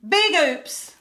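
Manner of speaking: real annoyed near-yell. Real, not acted. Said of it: "I'm sorry, I'm yelling".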